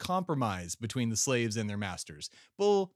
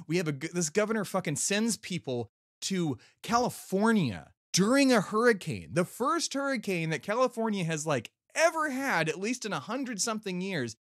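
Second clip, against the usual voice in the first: angry rant; 6 semitones higher than usual. Real, not acted. Caller ranting about the governor, heated high-pitched anger. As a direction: let the outrage climb up the pitch. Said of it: "I'm angry".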